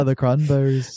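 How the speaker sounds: mimicking a bad Liverpool accent. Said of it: "You sound like fucking [name], like, [name]".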